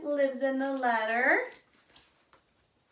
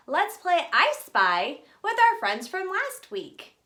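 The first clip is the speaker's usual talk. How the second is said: ordinary delivery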